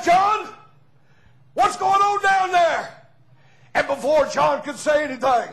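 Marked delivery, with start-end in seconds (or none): 0.0-3.1 higher, louder call from the window; slave owner calling down. Retold or imitated. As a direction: shout down to the sawmill boss, slowly. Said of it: "He said".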